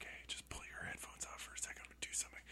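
hushed whisper, imitating an actor; conspiratorial whisper. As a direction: whisper it secretly to the sound guy. Actor confides in sound guy.

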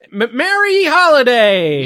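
loud, high, slow holiday cheer; festive cheer. Acted. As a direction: draw out the greeting joyfully. Festive greeting shouted to open.

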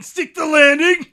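mock coach's yell. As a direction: yell it like a gymnastics spectator.

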